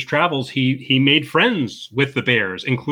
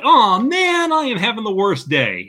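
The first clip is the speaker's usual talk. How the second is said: much higher, whining about a spilled coffee; mock whiny annoyance; 8 semitones higher than usual. Podcaster imitating a man annoyed at spilled coffee, mock whine. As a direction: sigh it out like a minor inconvenience. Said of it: "you're like".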